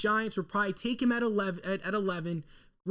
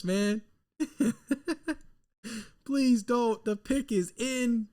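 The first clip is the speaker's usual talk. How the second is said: ordinary delivery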